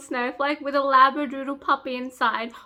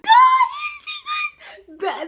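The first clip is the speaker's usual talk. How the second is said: excited squeal; 6 dB louder, much slower than usual. Real, not acted. Reader can't contain joy to viewers, shrieking squeal. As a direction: burst out squealing. Bursts out happy mid-retelling.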